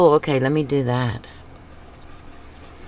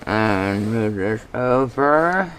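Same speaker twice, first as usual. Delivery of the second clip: brush in mouth; slower than usual. Real, not acted. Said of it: "talking with a brush in my mouth".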